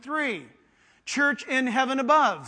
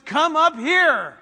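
loud, high, drawn-out call; trumpet-like summons; 4 semitones higher, 6 dB louder, slower than usual. Acted. Heavenly voice summoning John, loud trumpet-like proclamation. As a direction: ring it out slowly like a trumpet from heaven. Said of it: "it's the voice of a trumpet".

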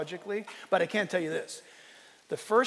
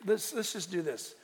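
normal talking voice

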